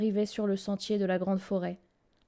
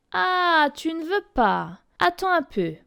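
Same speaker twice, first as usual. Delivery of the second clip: menacing; 6 semitones higher, 3 dB louder than usual. A narrator's reading. Girl threatens the wolf.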